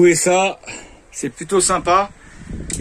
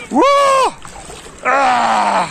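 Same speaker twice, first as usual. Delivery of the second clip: shocked shout in ice cold water; 5 semitones higher than usual. Real, not acted. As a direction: cry out hitting freezing water. Jumping into icy water.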